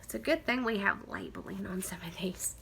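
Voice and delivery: ordinary talk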